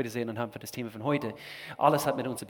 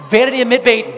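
much higher, much louder rallying shout; 10 semitones higher, 15 dB louder, faster than usual. Real, not acted. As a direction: fire up the crowd with it. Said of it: "ihr seid lahm".

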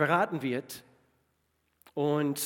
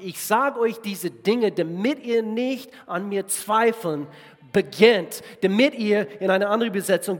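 higher, louder preaching push; full-force preaching; 5 semitones higher, 9 dB louder than usual. Real, not acted. Preacher urging the church not to doubt, full-force preaching. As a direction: drive the verse home to the congregation.

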